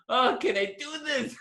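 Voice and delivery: very high, comic nervous wail; sweating self-doubt. Retold or imitated. Actor arriving on set unprepared, sweating, comic panic. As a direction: retell the jitters in a high, worried pitch. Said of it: "I'm sweating because I'm going".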